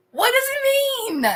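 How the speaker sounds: very high, comic squeal of bafflement; baffled cry. Real, not acted. Baffled woman asking viewers about slang, high squealed plea. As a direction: squeal the question in exasperation.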